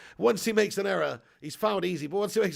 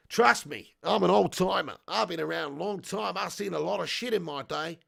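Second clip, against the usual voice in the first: hammed-up Aussie old-timer; bad Australian accent; 2 semitones higher, 3 dB louder than usual. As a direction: read it in a broad, mangled Australian accent. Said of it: "sorry for that abomination of an accent".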